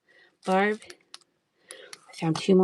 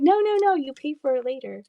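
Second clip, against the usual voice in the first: crowd correcting; 7 semitones higher than usual. Retold or imitated. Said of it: "And everyone's like".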